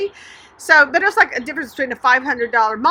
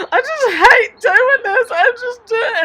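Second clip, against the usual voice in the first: high, slow, tearful and louder; breaking down; 11 semitones higher, 6 dB louder than usual. Retold or imitated. Tired saleswoman confessing to the kind company president, sobbing. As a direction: choke the words out as you start to cry. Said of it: "lost it".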